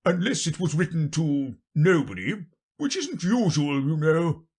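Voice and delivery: high, forceful king; explaining king. Acted. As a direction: explain pompously as a storybook king. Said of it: "said the king".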